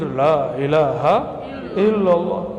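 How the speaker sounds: slow, drawn-out dhikr chant; Abah Anom. Retold or imitated. Preacher shows listeners how an elder did dhikr, slow drawn-out chanting. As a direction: stretch each word out like devotional chanting. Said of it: "Abah Anom dulu zikir".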